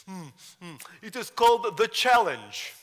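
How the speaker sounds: put-on eager boy in a Russian accent. Young Gary with Russian accent.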